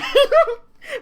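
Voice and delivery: squealing high burst of laughter; loud high laugh. Real, not acted. Laughs at "he's a bit hot.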